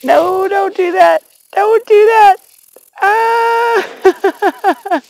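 real startled shriek; startled squeal. Real, not acted. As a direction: yelp as the bug jumps at you. Bug jumps toward her.

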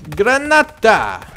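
loud, slow, drawn-out cry of Granata; mock soldier shout. Playing a German soldier, shouts Granata. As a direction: bellow it like a soldier in the game. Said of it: "let's play as the Germans".

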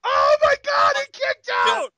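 very high stunned shout; shocked shout. Real, not acted. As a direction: scream in disbelief at the kickout. Stunned kickout reaction.

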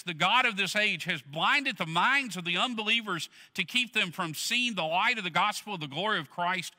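usual speaking voice

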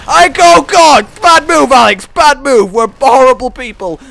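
very loud, high startled yells; panicked shouting. Real, not acted. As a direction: shout in alarm mid-fight. Panics in sudden game fight.

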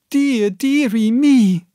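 alarmed mother wailing; 1 mother's cry. A narrator's reading. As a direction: wail it in a mother's voice. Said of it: "cried my mother".

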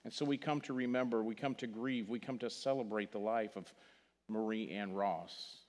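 ordinary talk

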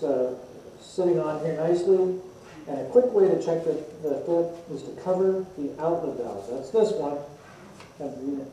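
muffled behind respirator; speaking inside respirator; through a face mask. Real, not acted. Seen 1: Respirator covers mouth and nose throughout; reference frames show no mask.

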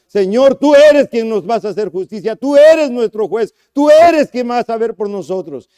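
high, loud proclaiming praise; loud fervent prayer. Acted. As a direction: declare it boldly to God. Pablo and Silas praying in jail.

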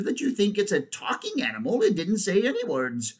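high-pitched bird character; incredulous jackdaw. Acted. Jackdaw figure in story.

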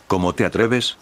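ordinary delivery, nothing marked

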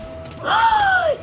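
sharp high gasp; surprised gasp. Real, not acted. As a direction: gasp in shock. Sudden surprise in fight.

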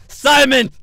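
father calling his son. Pretend. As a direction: shout the name high and loud.

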